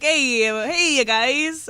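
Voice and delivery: stretched mock Italian-American accent; attempted Italian-American accent. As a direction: try out a hammy Jersey Italian accent. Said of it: "I've tried to do, like".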